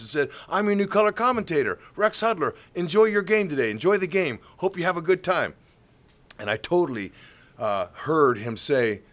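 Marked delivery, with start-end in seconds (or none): 0.5-5.5 high, loud greeting to passing fans; glad-handing politician. Retold or imitated. New commentator handing cards to fans, glad-handing. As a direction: work the crowd like a campaigner.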